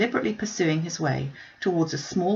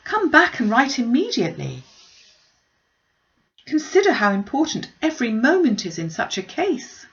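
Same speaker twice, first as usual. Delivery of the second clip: higher and louder, pressing; urgent plea; 6 semitones higher, 3 dB louder than usual. A narrator's reading. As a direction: push him to act at once. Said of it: "she cried".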